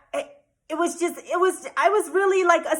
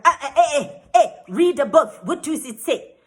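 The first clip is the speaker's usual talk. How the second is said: imitating an indignant official, loud and higher; raised-voice impression of an irritated man; flustered, angry official; 4 semitones higher, 6 dB louder than usual. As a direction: cut in with a loud mock-angry contradiction. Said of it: "flustered and angry".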